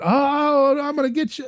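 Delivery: ordinary delivery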